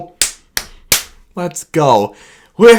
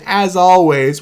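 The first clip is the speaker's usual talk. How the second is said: usual speaking voice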